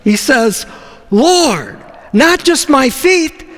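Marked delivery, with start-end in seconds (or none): 1.1-3.4 much higher, louder, eager plea; eager about-face. Retold or imitated. Peter begging Jesus to wash him all over, high and eager. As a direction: swing round, wanting everything now. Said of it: "the quickest one eighty".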